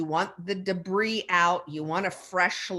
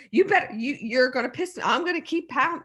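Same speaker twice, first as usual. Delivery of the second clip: raised voice; 7 semitones higher, 4 dB louder, faster than usual. Retold or imitated. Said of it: "I said".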